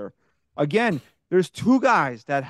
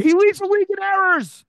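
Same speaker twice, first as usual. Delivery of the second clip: angry shout; 14 semitones higher, 5 dB louder than usual. Real, not acted. Ranting about errors.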